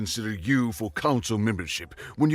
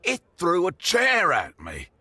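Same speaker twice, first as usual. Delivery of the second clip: outraged exclamation; 8 semitones higher, 5 dB louder than usual. A narrator's reading. Drunk storyteller's outraged climax.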